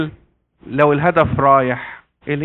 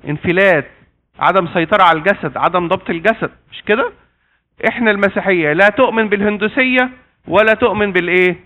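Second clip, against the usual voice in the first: usual speaking voice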